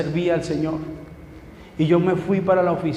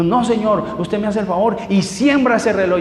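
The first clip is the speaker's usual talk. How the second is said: louder, higher stern order; firm command; 5 semitones higher, 4 dB louder than usual. Retold or imitated. Wife ordering husband to give the watch, loud and stern. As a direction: lay down the law.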